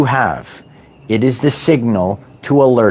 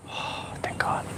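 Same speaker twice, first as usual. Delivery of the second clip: sighing relief; 20 dB quieter than usual. Pretend. Relief at being safe home.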